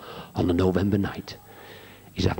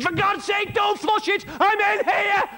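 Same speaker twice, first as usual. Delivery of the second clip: panicked shout; slower than usual. Retold or imitated. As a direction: shout up in a panic not to flush. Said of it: "He says".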